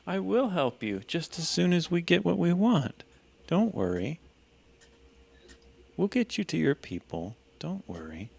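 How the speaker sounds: sweetly reassuring. Acted. Soothing the captive mermaid.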